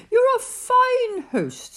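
butter him up; flattering bird. Acted.

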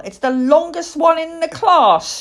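put-on high, loud, boastful child; proud little girl. Little girl showing off her ponytail, proudly. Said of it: "she added proudly".

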